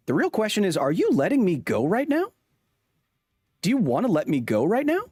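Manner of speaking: high, swooping acted disbelief in rising questions; incredulous challenge. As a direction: challenge the boss, pitch climbing to the end. Acted dispute over being fired.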